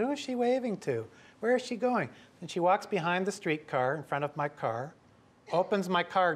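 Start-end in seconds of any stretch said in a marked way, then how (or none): 0.0-2.1 puzzled. Retold or imitated. Narrator voices his puzzled self.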